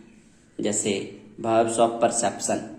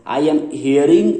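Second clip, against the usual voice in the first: very slow, drawn out; slow wrong example; 2 semitones higher, 4 dB louder, much slower than usual. Shown as a demonstration. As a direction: read the wrong version slowly. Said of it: "ऐसे नहीं बोलेंगे".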